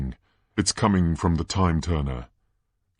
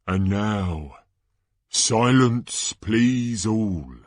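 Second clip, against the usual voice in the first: hushed, slow build to high shouted reveals; Ludo Bagman, dramatic announcer; 3 semitones higher, 4 dB louder, slower than usual. Acted. Announcer introducing the dragons, suspenseful then bellowing. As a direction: draw out the suspense, then explode.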